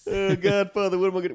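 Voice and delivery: high, loud mocking whine. Mocking the singer in the Godfather, a high loud whine. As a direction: mimic him to make fun of him. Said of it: "when he's making fun of him".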